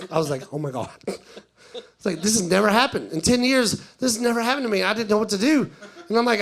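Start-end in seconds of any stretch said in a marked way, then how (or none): 0.5-0.9 low, hushed horror to yourself; re-enacted quiet mortification; mortified aside. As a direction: drop pitch and volume, sinking in shame. Comedian realizes his awful blunder.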